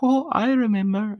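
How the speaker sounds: hammed-up romantic recollection; imitated movie line. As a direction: swoop up as it comes back. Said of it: "And then he was like".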